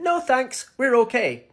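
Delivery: high-pitched mimicry of American girls; imitated girls turning him down; American girls declining. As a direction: put on a high, polite brush-off. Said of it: "They said".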